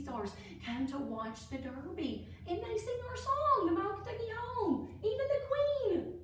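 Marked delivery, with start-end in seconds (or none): 2.5-6.1 much higher, gushing with delight; excited. Real, not acted. Teacher telling children the Queen sings their song, excited and high. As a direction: squeal it out like it's the coolest thing.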